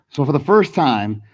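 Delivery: normal talking voice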